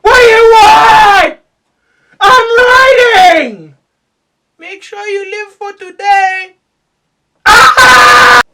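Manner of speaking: high, drawn-out screamed outburst; hammed-up rage at full volume; pissed-off songwriter. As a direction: scream like an interrupted, furious writer. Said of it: "John just got pissed while writing".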